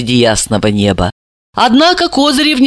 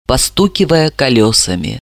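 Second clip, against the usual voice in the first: plain reading voice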